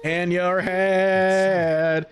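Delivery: very slow drawn-out echo. Acted. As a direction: stretch every word, far away. Repeating a line slowly.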